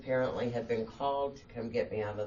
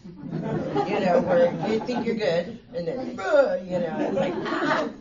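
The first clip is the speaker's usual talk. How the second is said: higher and louder, breaking into laughter; laughing while telling; 8 semitones higher, 7 dB louder than usual. Real, not acted. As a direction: laugh through it, ending in a yelp. Laughing about withdrawal pains.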